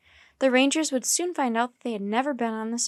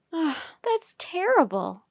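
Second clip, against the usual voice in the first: slow, higher-pitched dismay; a child's distressed reaction; 6 semitones higher, slower than usual. Acted. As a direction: draw it out, appalled at the news. Annie reacts to news of poaching.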